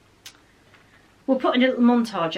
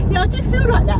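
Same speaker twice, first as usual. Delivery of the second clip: laughing; 12 semitones higher, 7 dB louder than usual. Real, not acted. Laughs at partner's grim joke.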